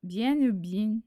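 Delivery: slow learner's try at Marseille French; nearly Marseille accent, slowed down; attempted Marseille accent. Shown as a demonstration. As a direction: carefully copy a Marseille accent.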